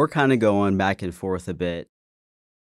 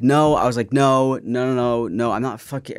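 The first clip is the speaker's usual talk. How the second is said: loud, rapid-fire string of noes; retold protest, pitched up and insistent; emphatic denial; 5 semitones higher, 7 dB louder than usual. Denying it to the freaked-out date, emphatic and loud.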